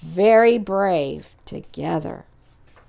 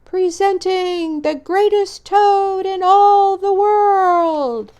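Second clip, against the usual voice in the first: high, slow, loud announcer; booming showman's announcement; strange far-off voice; 9 semitones higher, 4 dB louder, slower than usual. Acted. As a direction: announce it grandly from afar. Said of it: "A strange voice from far away said".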